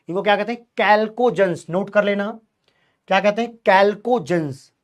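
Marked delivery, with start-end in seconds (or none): none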